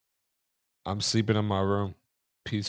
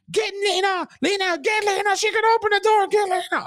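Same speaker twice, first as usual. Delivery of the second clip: high, loud panicked shriek; terrified scream; 5 dB louder than usual. Retold or imitated. Terrified boy shrieking to his mom through the door. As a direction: scream for your life to your mom.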